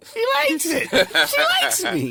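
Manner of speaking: very high squealing voice; mock squeal. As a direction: squeal it like a giddy girl. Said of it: "She was like, "Mikey".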